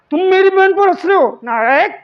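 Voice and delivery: mock confrontation. Teacher challenging someone laughing at his sister, mock confrontation.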